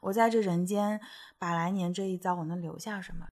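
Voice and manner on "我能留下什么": normal talking voice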